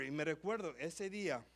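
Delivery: usual speaking voice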